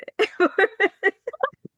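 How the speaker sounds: bright burst of laughter up high; a real high-pitched laugh. Real, not acted. Laughs at her own funeral joke.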